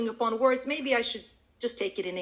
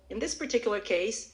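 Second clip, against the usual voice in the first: ordinary talk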